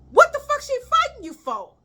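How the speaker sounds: high-pitched imitated disbelief; indignant cousin. Cousin asking why the girl is fighting, high indignant question. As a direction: demand it in a high voice.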